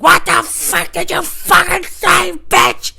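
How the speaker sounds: high, loud enraged yell, drawn out; furious mock shout. Customer turning back on the laughing coworker, enraged shouting. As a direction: imitate an enraged customer screaming.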